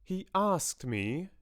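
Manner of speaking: slow, high, deliberate words; measured, over-careful delivery; spelling it out carefully. A narrator's reading. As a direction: set out each word carefully, with pauses. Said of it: "spelling the words out carefully".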